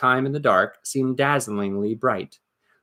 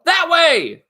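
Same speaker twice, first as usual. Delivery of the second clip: much higher, loud call; goblin yell; 6 dB louder than usual. Acted. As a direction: yell the other way. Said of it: "others yelled".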